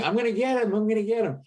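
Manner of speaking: high-pitched drunken threat, imitated; retold scream at normal volume; mock screaming.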